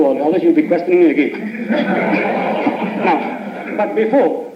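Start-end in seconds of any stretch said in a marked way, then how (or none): 1.7-3.0 high laugh. Real, not acted. Teasing Mother about questioning.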